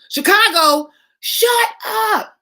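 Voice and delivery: very loud, high, delighted shriek; can't believe the invitation; thrilled disbelief. Real, not acted. Hearing Oprah's invitation.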